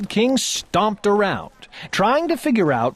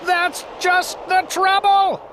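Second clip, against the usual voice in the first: high, furious royal bellow; old king bellowing high in a strange voice; 14 semitones higher than usual. A narrator's reading. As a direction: roar it up high like an angry king. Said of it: "bellowed the king".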